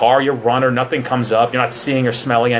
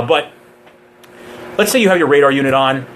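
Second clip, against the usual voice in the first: ordinary talk, nothing marked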